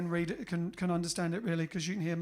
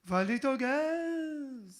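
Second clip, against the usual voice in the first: put-on higher, slow, crooning outrageous French accent; 3 semitones higher, slower than usual. S0 croons an old French song in an outrageous French accent. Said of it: "Outrageous French accent".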